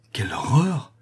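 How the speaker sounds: mock-horrified exclamation, voice swooping; stagey cry of horror. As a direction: exclaim in feigned outrage. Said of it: "s'écria monsieur Ferrand".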